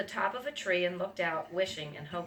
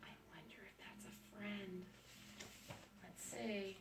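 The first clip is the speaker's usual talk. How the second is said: hushed, breathy wonder; whisper; 16 dB quieter than usual. Real, not acted. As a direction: whisper to build suspense. Hushed suspense for child.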